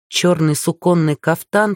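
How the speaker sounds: usual speaking voice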